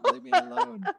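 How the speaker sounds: laughing mock protest.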